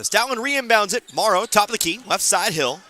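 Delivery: talking through hiccups; hiccupping between words. Real, not acted. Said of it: "Now I got the hiccups".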